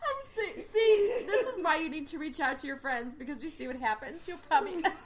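high-pitched laughing speech; laughing so hard she can barely speak. Real, not acted. Laughing fit.

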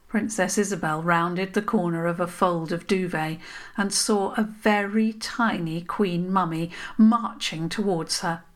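usual speaking voice

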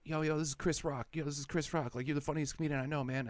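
a bad put-on celebrity hype drop, rattled off quickly; fake Chris Rock. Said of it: "That's the same voice doing all of those voices".

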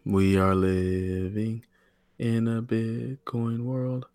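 slow, quiet dramatic trailer line; hushed, very slow announcer tone; movie trailer voice. Shown as a demonstration. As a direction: draw it out like an epic movie tagline. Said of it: "No, that's lame".

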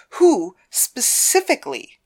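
insistent mistress interrogating; demanding. Acted. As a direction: demand a name, word by word. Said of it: "she demanded".

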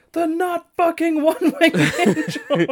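high, slow excited yell through laughter; excited shout. Retold or imitated. Host imitating a commentator excitedly shouting the move name. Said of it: "Excalibur excitedly shout".

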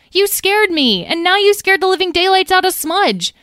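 high, loud upset scolding. A narrator's reading. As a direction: tell off a friend who scared you. Talora scolds Tom for the secret.

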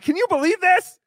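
exaggerated gleeful disbelief; mock-incredulous idiot. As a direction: crow over someone else's foolishness. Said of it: "and being like".

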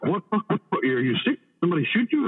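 high, rapid-fire alarmed questions; retelling the underboss's startled reaction. Retold or imitated. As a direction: pepper him with worried whats. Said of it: "And he's like".

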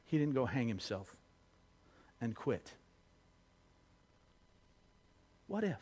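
soft, low wondering with long pauses; hushed solemn musing. Real, not acted. Preacher imagining Judas forgiven, hushed and pensive. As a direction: pose it quietly and let it hang.

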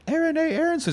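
put-on very high small kid voice. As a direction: squeak it like a little boy. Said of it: "What's your name?".

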